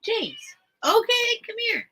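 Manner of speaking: high singsong call to the bird; pet talk. Real, not acted. Calling to her pet bird.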